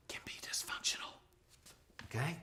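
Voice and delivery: hushed whisper on dysfunctional; breathy stage whisper. Shown as a demonstration. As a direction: whisper it like a secret. Whispered aside in lesson.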